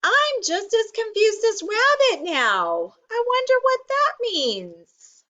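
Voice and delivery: very high, squeaky puzzled voice; high-pitched, put-on confusion; puzzled character voice. A narrator's reading. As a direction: wonder aloud in a tiny high voice. Aside in a put-on voice.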